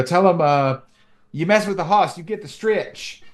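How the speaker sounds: lightly voiced macho ostrich brag; Boss Ostrich line. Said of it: "tough things for Boss Ostrich to say".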